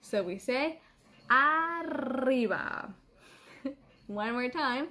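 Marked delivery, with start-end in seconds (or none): 1.3-2.9 "arriba" slow with a long trilled R; "arriba" stretched, R vibrating strongly; strong rolled R. Shown as a demonstration. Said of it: "your tongue has to vibrate".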